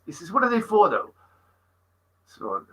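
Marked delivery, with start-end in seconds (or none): 0.3-1.1 high, lilting Welsh question; imitated Welsh friend, probing; mock Welsh accent. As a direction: put on a high-pitched Welsh voice. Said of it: "Welsh, Welsh he was as well".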